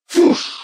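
high, short whooshing shot noise; imitated energy bolt. A narrator's reading. Bolt hits Katie in the shoulder, a high imitated whoosh. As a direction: voice a bolt whizzing in.

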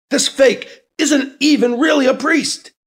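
high, loud furious shout. Acted. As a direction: spit it out in outrage. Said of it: "McIntyre shouts".

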